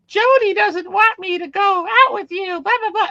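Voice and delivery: very high, loud mock child voice; imitating movie kid. Retelling the kid's lines about Jody, mock child voice. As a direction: put on a piercingly high little-kid voice. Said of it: "was the kid saying that".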